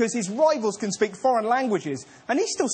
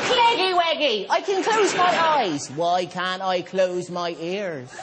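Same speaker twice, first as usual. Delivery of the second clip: put-on child's voice; 4 semitones lower, slower than usual. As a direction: ask it like a curious child. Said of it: "Imagine the leaders answering their questions".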